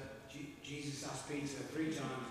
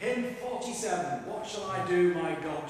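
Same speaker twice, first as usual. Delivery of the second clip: loud, slow announcement over the room; hymn announcement; 4 semitones higher, 11 dB louder than usual. Real, not acted. Preacher announces the hymn to the congregation, loud and slow. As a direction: call out the hymn number to everyone.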